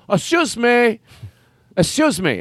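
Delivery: high, pushy "Excuse me" repeated; insistent usher. Retold or imitated. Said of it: "This is a character that I made up".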